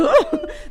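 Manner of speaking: high, loud burst of laughter; nervous laugh. Real, not acted. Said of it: "Tô nervosa".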